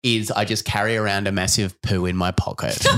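normal talking voice

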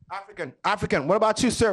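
normal talking voice

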